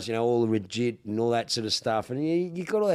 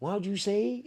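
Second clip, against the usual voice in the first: confused bartender; 10 semitones higher than usual. Retold or imitated. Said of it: "She goes".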